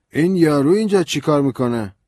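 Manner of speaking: put-on high, loud drunk voice; hostile drunk. A narrator's reading. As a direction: eye the stranger with distrust. Suspicious drunk lawyer.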